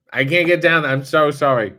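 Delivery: ordinary talk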